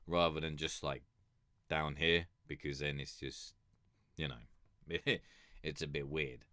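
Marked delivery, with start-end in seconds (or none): none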